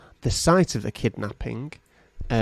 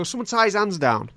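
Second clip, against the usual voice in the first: raised, high-pitched command; exasperated order; 7 semitones higher than usual. Acted. Torturer to helpers as the captive squirms, exasperated order. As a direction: call out for help, put out.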